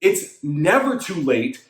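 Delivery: slow, deliberate, every word weighted; slow and emphatic. Shown as a demonstration. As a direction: lay it down slowly so it sinks in. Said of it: "I'm gonna say this one time".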